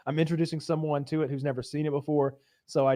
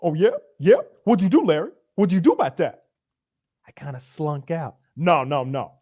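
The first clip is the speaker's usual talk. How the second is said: loud, higher, laughing pep talk; hyped-up tough guy; 5 semitones higher, 8 dB louder than usual. Retold or imitated. Leon urging Larry to get in that ass, loud and pumped. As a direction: fire him up to fight back. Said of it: "Leon's like".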